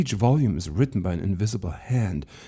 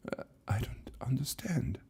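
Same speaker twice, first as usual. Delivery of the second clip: stunned whisper; 5 semitones higher, 10 dB quieter than usual. Acted. Lucas reads of his own death.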